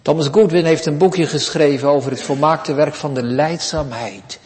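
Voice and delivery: ordinary delivery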